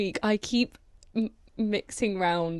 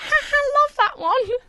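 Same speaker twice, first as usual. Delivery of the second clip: very high, mock-polite chirp; high, falsely cheerful imitation of the crowd; fake polite laugh; 5 dB louder, slower than usual. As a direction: squeak out a fake amused reply. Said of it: "Everyone's like".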